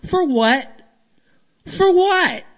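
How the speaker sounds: high, exasperated outburst; fired up. Real, not acted. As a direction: demand an answer, voice jumping up in real indignation. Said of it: "just kind of fired up".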